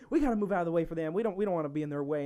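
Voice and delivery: put-on fast light Texas accent; fast southern accent. Teen imitates her mom in a Texas aisle, southern accent. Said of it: "I'm talking in a southern accent".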